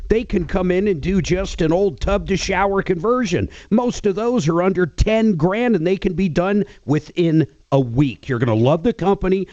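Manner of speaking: lively host-read radio endorsement. Real, not acted. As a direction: sell it to listeners with emphatic key phrases. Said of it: "Got that radio voice".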